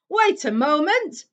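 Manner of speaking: loud, slow bellow. A narrator's reading. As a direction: roar at the fireplace. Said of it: "he bellowed at the fire".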